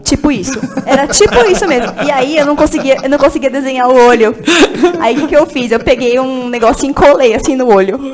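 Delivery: conta rindo e mais alto, divertida com o próprio fracasso. Real, not acted. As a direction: ri enquanto descreve o peixe torto. História engraçada dos peixes de EVA. (entre risadas, contando a trapalhada)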